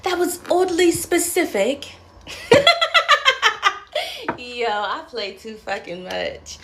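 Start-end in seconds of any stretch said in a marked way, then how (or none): 2.3-4.3 long squealing laugh; high cackling laugh. Real, not acted. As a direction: burst into laughter. Cackles at own joke.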